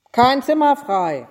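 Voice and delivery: curt innkeeper at the door; gruff refusal. A narrator's reading. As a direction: turn them away firmly. Innkeeper turns late guests away.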